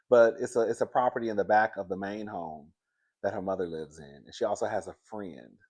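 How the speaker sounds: usual speaking voice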